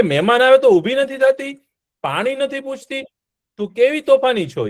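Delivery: put-on a stern sage telling off a careless girl; mock-angry scolding of a storyteller. A narrator's reading. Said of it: "એટલે દુર્વાસા મુનિને ક્રોધ આવ્યો".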